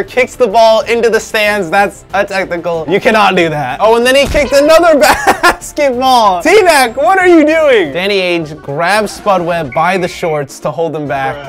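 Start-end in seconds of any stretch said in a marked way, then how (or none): 3.8-6.4 high exclamation bursting into laughter; laughing disbelief. Real, not acted. Cracking up at second kick. 6.4-7.9 high, exasperated call-out; mock scolding. Calling out player in clip.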